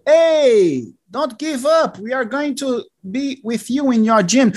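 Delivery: rally a tired friend; encouraging friend. Retold or imitated.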